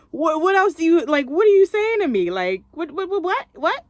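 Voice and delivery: high, loud incredulous what; indignant disbelief. Retold or imitated. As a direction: act baffled and offended. Indignant at coworker's comment.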